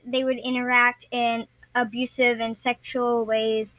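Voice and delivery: usual speaking voice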